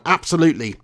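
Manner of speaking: ordinary talk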